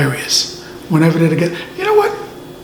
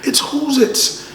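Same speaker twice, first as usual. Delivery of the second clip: higher, gasping mock surprise; excited recognition; 9 semitones higher, 3 dB quieter than usual. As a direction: act out suddenly spotting somebody famous.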